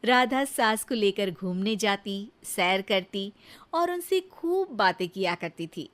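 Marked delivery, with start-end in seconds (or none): none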